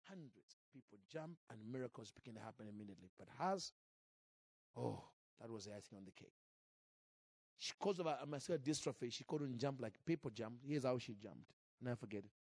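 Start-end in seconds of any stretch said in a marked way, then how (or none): none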